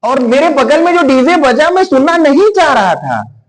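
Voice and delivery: ordinary talk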